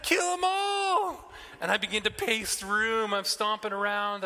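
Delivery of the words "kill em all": high, loud, slow mock battle cry; hammed-up savage kid voice; mock bloodthirsty kid.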